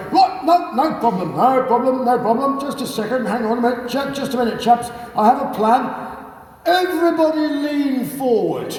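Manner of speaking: put-on high, hearty old RAF chap; rally the chaps like a cheery ex-RAF officer; jolly RAF type. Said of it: "So Charlie, meanwhile, is going".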